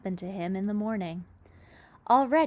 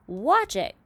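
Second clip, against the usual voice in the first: cry of warning; 4 semitones higher, 6 dB louder than usual. A narrator's reading. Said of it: "cried Miss Spider".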